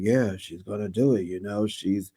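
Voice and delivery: low deep mock husband voice; impression of Dr. Damon. Retelling Damon backing Heavenly, in a low voice. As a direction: drop the voice down for the man. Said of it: "Doctor Damon".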